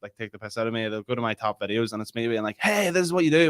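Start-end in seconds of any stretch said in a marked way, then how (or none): 2.6-3.5 higher, louder put-on presenter voice; mock-chirpy video host; radio voice. Imitating own top videos for mocking friends, radio voice. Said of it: "your radio voice".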